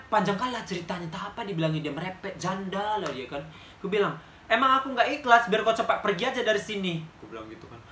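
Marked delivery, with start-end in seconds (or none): 4.5-7.1 angry retort. Retold or imitated. Narrator snapping back at beggar.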